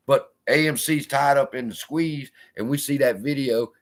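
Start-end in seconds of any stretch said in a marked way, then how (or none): none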